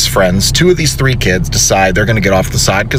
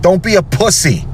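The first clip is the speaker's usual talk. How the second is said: scolding; 7 semitones higher than usual. Retold or imitated. Scolding kid from car window.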